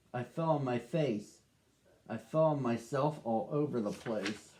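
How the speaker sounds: calm first-person storybook reading as the dog Duke; story narration. A narrator's reading. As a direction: read it gently to children, as the dog telling his own tale.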